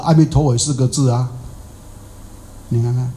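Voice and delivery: ordinary talk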